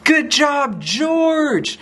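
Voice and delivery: high, drawn-out happy cheer. A narrator's reading. The kids praise George, high cheering. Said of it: "They cheered".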